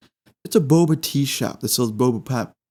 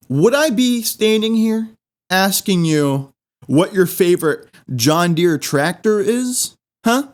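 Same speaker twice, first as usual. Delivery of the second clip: higher pitched mock-outraged rant; exasperated rant; 6 semitones higher than usual. Customer ranting at the cashier, mock outrage. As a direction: lay into him in disbelief.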